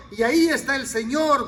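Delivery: usual speaking voice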